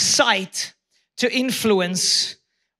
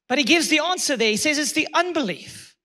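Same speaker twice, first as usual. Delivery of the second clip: normal talking voice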